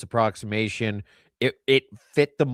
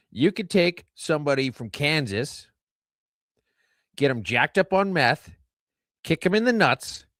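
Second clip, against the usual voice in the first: ordinary talk, nothing marked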